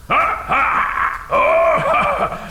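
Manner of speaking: high gleeful laughing oh; triumphant laugh. Acted. Dragon certain he solved the riddle, high gleeful laugh. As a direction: cackle in triumph.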